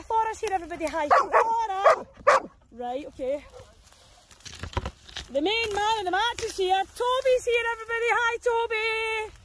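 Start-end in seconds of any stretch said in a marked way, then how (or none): none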